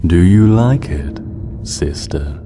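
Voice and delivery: slow, cold and menacing, echoing in her head; icy monster voice. Acted. Dark sister taunting Celestia, icy and menacing. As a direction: ice water sliding into the mind. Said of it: "An ice water voice slid through her mind".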